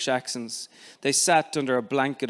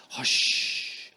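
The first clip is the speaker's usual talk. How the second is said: breathy whisper of hush. Acted. Father hushing son in the street, whispered. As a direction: shush him without voice. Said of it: "he said to me again".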